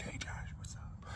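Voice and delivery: soft sneaky whisper; hushed creepy greeting; spider whispering. Retold or imitated. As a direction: whisper it in his ear like a creepy little spider. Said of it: "whispering in my ear".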